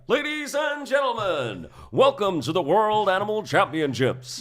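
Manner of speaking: big, high, drawn-out ring announcer; ringleader announcer. Shown as a demonstration. Host voicing ringleader to crowd, grand announcing. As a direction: boom it out like a ringmaster. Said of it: "And, and the ringleader".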